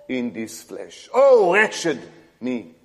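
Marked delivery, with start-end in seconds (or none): none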